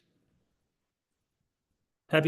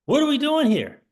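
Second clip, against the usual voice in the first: exasperated; 10 semitones higher than usual. Real, not acted. Exasperated at the losses.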